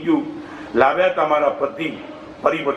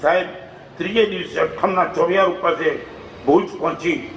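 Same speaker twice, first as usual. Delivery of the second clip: ordinary delivery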